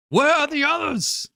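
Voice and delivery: high, urgent holler; shouted question. Acted. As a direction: call out across a distance. Game master voices alarmed villagers.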